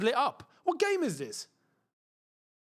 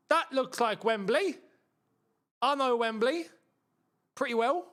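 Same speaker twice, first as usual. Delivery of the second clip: Cockney slip; 6 semitones higher than usual. Real, not acted. Streamer spotting Wembley, lightly Cockney. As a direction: let a hint of Cockney slip out. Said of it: "the London Cockney accent comes out".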